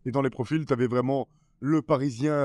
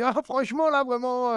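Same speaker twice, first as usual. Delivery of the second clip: exaggerated high mimicry; Edgar Rive; 10 semitones higher than usual. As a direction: impersonate a teasing colleague, pitched way up. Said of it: "là, t'as Edgar Rive qui est là".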